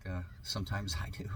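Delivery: half-laughing whispered confession; whispered aside. Real, not acted. Host admits to listeners in a laughing whisper.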